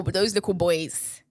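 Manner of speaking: ordinary talk, nothing marked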